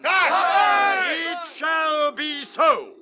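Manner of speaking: high, loud proclamation; firm declaration. Acted. King agreeing before the host, ringing declaration. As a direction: declare it to all.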